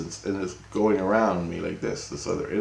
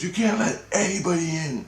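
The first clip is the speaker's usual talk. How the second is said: Jesus warning; 10 semitones higher than usual. Retold or imitated. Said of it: "he goes".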